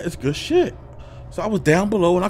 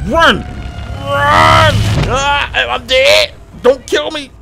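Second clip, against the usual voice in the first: loud, higher cries of alarm; panicked yelling; 6 semitones higher, 5 dB louder than usual. Real, not acted. Player fleeing the thing from the TV, panicked yelling. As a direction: yell in a panic to flee. Monster coming through the TV.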